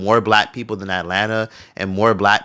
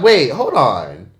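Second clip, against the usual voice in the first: startled hold on; much slower than usual. Retold or imitated. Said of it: "and I'm like".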